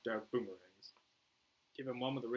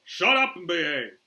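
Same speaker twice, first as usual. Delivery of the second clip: loud, drawn-out stern command; shouted order; 8 semitones higher, 16 dB louder than usual. Acted. Flag silences Boomer, loud stern order. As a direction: bellow it with authority.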